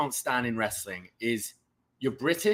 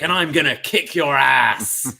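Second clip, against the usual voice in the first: louder, slow mock American wrestling promo; Americanized British promo; 7 dB louder than usual. Mocking Americanized British wrestlers with a slow, louder tough-guy line. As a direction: drawl it out like an Americanized Brit. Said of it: "like we hear from a ... Drew McIntyre".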